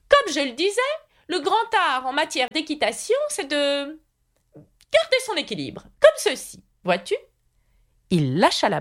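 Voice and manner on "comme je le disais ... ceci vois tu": high-pitched eccentric knight lecturing; knight, pompous teacher. A narrator's reading. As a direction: proclaim your lesson in a high character voice. (chevalier pédagogue, voix haut perchée)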